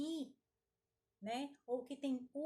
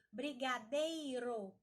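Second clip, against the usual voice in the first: "brigadeiro" slowly, with a long "ei"; teacher stretching the stressed "ei" of "brigadeiro"; strong syllable drawn out; much slower than usual. Shown as a demonstration. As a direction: drag out "dei" in "brigadeiro". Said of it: "aquela palavra que nós mais arrastarmos".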